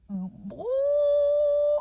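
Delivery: high, crying ooh; a sudden sob breaking out; tearful ooh. Real, not acted. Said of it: "So now I'm crying".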